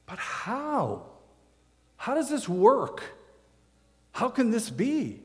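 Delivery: high, baffled, pleading questions; bewildered Nicodemus. Shown as a demonstration. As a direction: ask it as a man whose world just flipped. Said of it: "maybe put a little more feeling into it".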